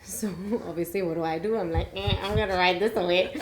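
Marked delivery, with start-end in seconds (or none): 1.9-3.3 put-on broad Australian accent. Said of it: "She's talking in accent".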